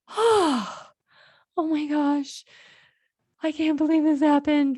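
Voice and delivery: high sighing exclamation of relief; retold relief. Mother hears her lost son is found, acted-out relief. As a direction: let out a big sigh, then high-pitched disbelief.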